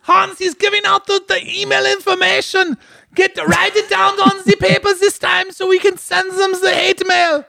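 very high-pitched cartoon shouting; mock frantic order. Ordering Hans to note the email, high mock shriek. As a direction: shriek urgent orders in a put-on accent.

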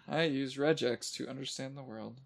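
soft chuckle through the words; laughing quietly. Real, not acted. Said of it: "repeating own quip to himself".

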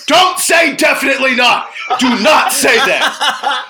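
high, loud mock-divine shout; urgent warning. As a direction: shout a frantic command, hammed up. God's urgent warning, acted in retelling.

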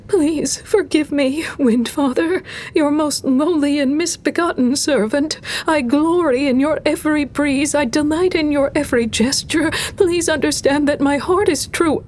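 high squeaky grovelling priestess; squeaky, pleading. A narrator's reading. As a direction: beg forgiveness in a thin, very high voice. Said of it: "her voice squeaky and pleading".

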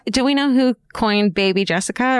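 ordinary talk

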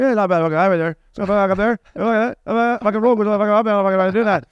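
imitating a thick Newfoundland bayman accent; a lightly done Newfoundland bayman accent, run-on and rough. Retelling the cab driver's talk, mild Newfoundland bayman accent. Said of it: "He's a fucking bayman".

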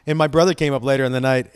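ordinary delivery

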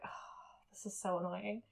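softly sighed, weary annoyance; retold exasperation. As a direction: imitate mom's quiet exasperated grumble. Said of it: "it's really annoying sometimes".

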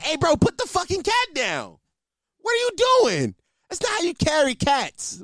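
high-pitched, exasperated telling-off; retold outraged uncle; mock scolding. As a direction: act out alarmed scolding, pitch shooting up. Scolding nephew carrying cat by neck.

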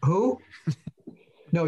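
ordinary talk, nothing marked

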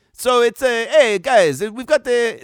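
high, loud hammed-up Italian accent. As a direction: call out to the guys in a comic Italian accent. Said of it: "that's my Italian accent".

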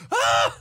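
yelp. Retold or imitated. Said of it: "And he's like".

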